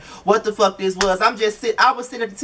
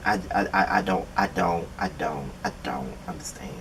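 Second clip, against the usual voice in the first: trailing off quieter and lower, lost for words; fading bewilderment; 12 semitones lower, 10 dB quieter than usual. Real, not acted. Vlogger trailing off, baffled by the conversation, fading to a murmur. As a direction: let the words die away in confusion. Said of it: "I just don't understand".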